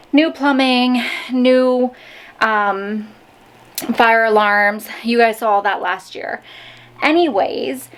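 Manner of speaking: ordinary delivery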